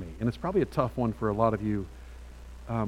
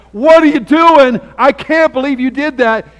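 put-on much higher and louder, slowed outraged child; voicing the child; 13 semitones higher, 18 dB louder than usual. Preacher voicing a spanked toddler to parents, outraged loud cry. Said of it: "No, he's saying".

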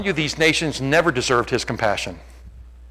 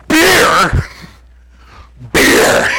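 loud, high cry of discovery; mock excited shout; 6 semitones higher, 9 dB louder than usual. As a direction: blurt it out like dudes bonding over beer. Two dudes escalate over beer.

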